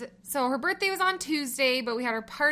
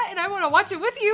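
eager; 8 semitones higher, 5 dB louder, faster than usual. Retold or imitated. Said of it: "And I was like".

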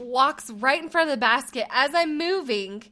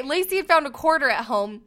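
ordinary talk, nothing marked

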